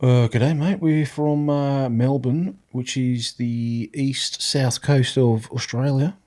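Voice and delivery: slow, exaggerated Australian accent. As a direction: play up the Aussie accent on request. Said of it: "Do your best Australian accent".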